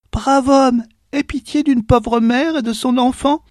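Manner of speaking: high, pleading voice of a poor mother; plaintive beggar mother. A narrator's reading. Poor mother begs the farmer, high plaintive plea. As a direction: beg the rich farmer for pity. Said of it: "une voix plaintive".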